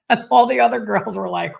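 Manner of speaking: said through a laugh; amused laughing lead-in. Real, not acted. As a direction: laugh as you set it up. Laughing while retelling.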